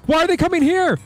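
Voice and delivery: panicked cry. Real, not acted. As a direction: yelp it as the enemies rush in. Enemies suddenly rushing his base.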